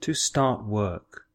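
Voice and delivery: "To start work" said slowly and clearly; drawn-out careful "To start work" for learners; slow classroom model of "To start work"; slow model phrase. Shown as a demonstration.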